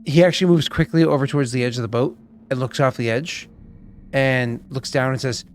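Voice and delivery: usual speaking voice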